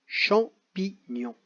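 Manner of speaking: champignon stretched out slowly, syllable by syllable; slow dictation. Shown as a demonstration. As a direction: draw out champignon for the children. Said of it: "Coche chaque syllabe dans laquelle tu entends". (champignon détaché très lentement)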